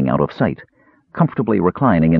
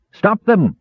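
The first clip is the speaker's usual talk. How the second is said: high shouted order; yelled; 13 semitones higher than usual. Acted. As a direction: bark the command at the soldiers. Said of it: "yelled the sergeant".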